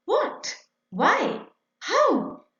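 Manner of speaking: high, wondering little questions; questioning voice. Acted. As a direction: ask them like a puzzled child, pitch leaping up. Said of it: "A question mark asks".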